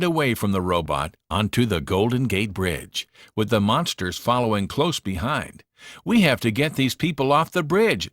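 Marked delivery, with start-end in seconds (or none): 6.0-8.1 urgent raised call; shouting. A narrator's reading. Susan on the bridge shouting to the monsters. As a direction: shout to the others. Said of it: "she shouted".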